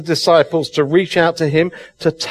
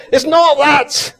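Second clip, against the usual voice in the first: building passion in the pulpit; excited preaching; 5 semitones higher than usual. Real, not acted. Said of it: "I'm getting so excited".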